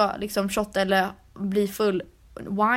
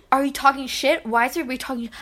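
mock drunk anger; 4 semitones higher, 3 dB louder, faster than usual. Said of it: "du var så arg".

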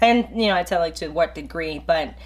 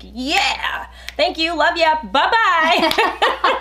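bright sing-song farewell, rising higher; breezy happy sign-off; cheery goodbye; 9 semitones higher, much slower than usual. Retold or imitated. Friend mimicking her overly cheerful goodbye, higher.

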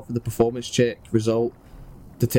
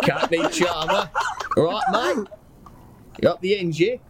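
higher-pitched, badly faked Cockney; Cockney slipping into Australian; 6 semitones higher than usual. Player losing hold of Petey's accent, Cockney gone Australian. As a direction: let the Cockney wander off towards Australian. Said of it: "Fuck, it's gone Australian".

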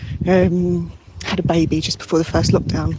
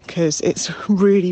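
normal talking voice

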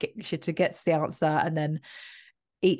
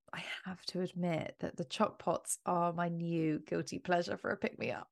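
hushed confession, unvoiced; whispering; 9 dB quieter than usual. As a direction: whisper it like a secret. Confessing guilty pleasure in ad.

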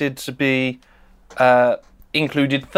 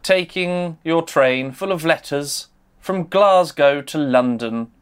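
dramatic storytelling. Acted. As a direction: paint the scene like an old storyteller. Dramatic storyteller scene setting.

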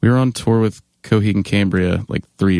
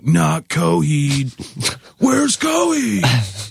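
drawn-out, high heckler's holler; imitated yelling; 8 semitones higher, much slower than usual. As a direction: mimic a kid yelling from the crowd. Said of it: "Like, yelling".

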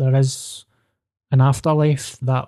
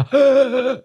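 high sudden intake of breath; sharp gasp; 11 semitones higher than usual. Shown as a demonstration. Showing the host how the air left him, sharp gasp. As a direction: suck the air in like a pump. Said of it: "It was like a".

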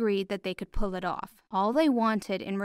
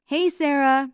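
louder, higher call across the room; shouted greeting; 6 semitones higher, 8 dB louder than usual. Acted. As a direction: call out to her eagerly. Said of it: "Brian shouted".

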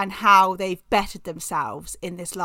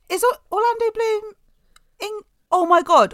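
much higher, louder gasp of realisation; sudden realisation; 13 semitones higher, 4 dB louder than usual. Real, not acted. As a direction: it suddenly dawns on you. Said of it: "sudden realisation".

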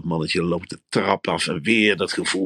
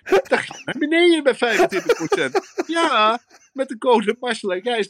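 high, laughing, eager pitch; excited scheming; 9 semitones higher than usual. Acted. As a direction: push the deal high and giddy with a laugh. Quoted inner thought, shopping. (hoog en lachend opgewonden)

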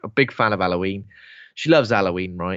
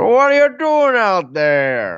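high, loud, slow mock shout from the neighbour; grumpy neighbour; 9 semitones higher, 6 dB louder, slower than usual. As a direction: call out like a nosy grumpy old man. Neighbour calls out, acted quote.

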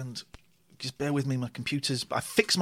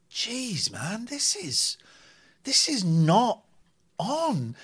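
high, halting incredulous thought; acted dismay; 8 semitones higher, much slower than usual. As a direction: replay appalled disbelief, pitched high. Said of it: "And I'm in bed thinking".

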